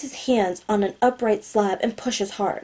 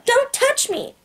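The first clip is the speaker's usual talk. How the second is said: put-on very high child's protest; tearful little girl outburst; slower than usual. A narrator's reading. Girl faking tears at the guards, high upset protest. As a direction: squeal it like an upset small child. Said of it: "turns up the waterworks and says louder now".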